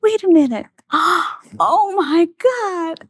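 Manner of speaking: high re-enacted amazement with a gasp; gasp, then stretch it out high; so excited. Wife stunned by her surprise gift, so excited. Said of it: "so excited".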